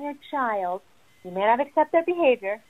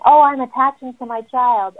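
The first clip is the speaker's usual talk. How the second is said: mock parent's proud announcement, higher and louder; louder, lifted voice imitating a parent; gushing parent; 4 semitones higher, 9 dB louder than usual. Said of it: "when I hear a parent saying".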